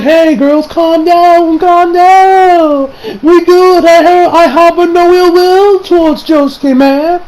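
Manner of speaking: high, loud mock pleading; hammed-up placating character. Character assuring the girls he means no harm, hammed-up placating. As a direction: act out a man frantically calming everyone down.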